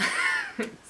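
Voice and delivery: a high, giggly burst of laughter; real laugh. Real, not acted. Vlogger laughing at the singer's drawing, high giggle. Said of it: "celui-là me fait profondément rire".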